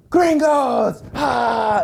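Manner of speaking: high, loud hostile shout; angry mob yell. Retold or imitated. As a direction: roar it like a charging mob.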